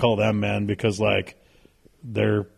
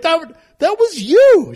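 high, loud, drawn-out mock yell; exasperated accusation; 8 dB louder, slower than usual. Wife tells daughter she was the culprit, slow high yell. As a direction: imitate a mother yelling the culprit's identity.

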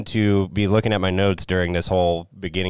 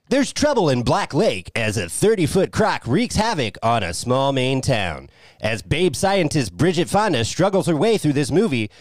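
slow, higher trailer narration; movie trailer voice; 4 semitones higher, 4 dB louder, slower than usual. Acted. Reads a mock movie synopsis.

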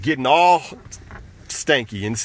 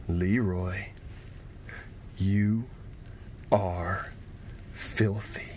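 low, soft, ominous calm; the so-help-me voice, hushed and slow; mother's dangerously quiet scolding; 6 semitones lower, 9 dB quieter, slower than usual. Acted. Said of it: "her voice got very, very calm and very quiet".

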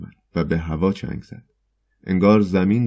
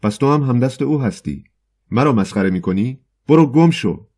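higher, louder furious yell; furious shout; 6 semitones higher, 5 dB louder than usual. Acted. Poet, helpless with anger, yells at the choirmaster, much louder. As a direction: rage at him and drive him off. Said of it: "از شدت خشم درمانده شده بود فریاد زد".

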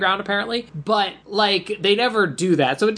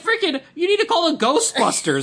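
exasperated yell; 11 semitones higher than usual. Retold or imitated. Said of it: "I'm like".

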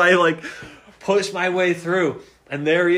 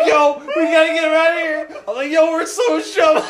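much higher and louder, laughing through the words; laughing panic; 11 semitones higher, 6 dB louder than usual. Retold or imitated. Dragging the friend out of the bathroom, laughing hard. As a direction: retell the panic while cracking up.

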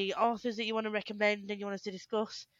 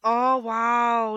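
ordinary talk, nothing marked

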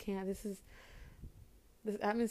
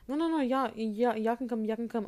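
imitating mom insisting; 2 semitones higher, 7 dB louder than usual. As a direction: mimic her mother's quick backpedal, pitched up. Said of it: "And my mom was like".

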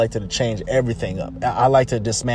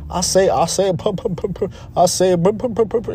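higher stuttering cartoon rooster, puh puh; Sanford the stuttering rooster; 6 semitones higher than usual. Lightly voiced cartoon rooster mocking denial, stuttering higher. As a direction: sputter like a blustery cartoon rooster. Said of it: "he was always stuttering".

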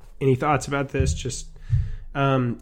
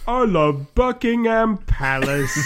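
slow, higher fake British gush; mock British; 8 semitones higher, slower than usual. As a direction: gush like a tourist posing as a Brit. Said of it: "They'll be like".

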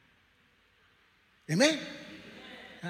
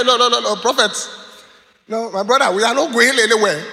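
higher, louder string of firm noes; blunt refusal; 5 semitones higher, 4 dB louder than usual. Retold or imitated. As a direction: imitate a friend shutting down the request. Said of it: "that's the way he said".

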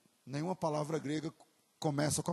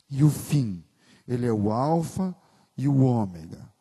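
slow, loud emphatic declaration; preaching proclamation; 11 dB louder, slower than usual. Acted. Pastor declaring Jesus to the congregation, slow and loud. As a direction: declare it slowly to the whole church.

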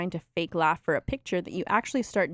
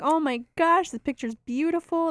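high, delighted gush; gushing abuela; 8 semitones higher than usual. Retold or imitated. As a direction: coo over the picture. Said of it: "they're like".

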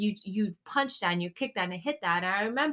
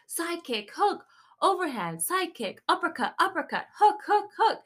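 rising, driving trainer calls; instructor calling combos; 7 semitones higher than usual. Retold or imitated. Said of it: "So he'll give you combinations".

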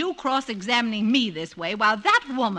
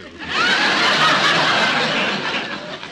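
high airy giggle, teasing; amused breathy giggle at his jealousy; giggling laugh; 11 semitones higher, 5 dB louder than usual. Acted. Wife laughs off jealous question.

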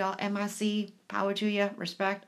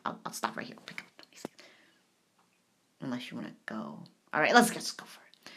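whisper; 10 semitones lower, 4 dB quieter, faster than usual. Real, not acted. Whispered sign-off.

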